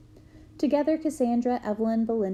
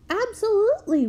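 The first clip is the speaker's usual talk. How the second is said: high, louder eager answer; eager yes; 11 semitones higher, 4 dB louder than usual. Retold or imitated. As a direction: jump at the offer. Kids eagerly accept ten dollars.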